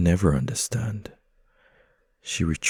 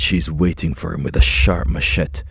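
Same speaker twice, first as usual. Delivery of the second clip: ordinary delivery, nothing marked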